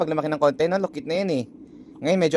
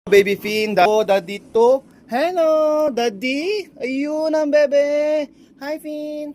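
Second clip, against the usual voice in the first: cooing greeting; 8 semitones higher, 5 dB louder, much slower than usual. Real, not acted. Dad presenting himself to his baby, high excited cooing. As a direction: coo a big hello at a newborn.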